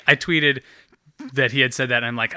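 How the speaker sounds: ordinary talk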